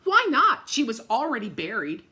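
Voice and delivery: ordinary delivery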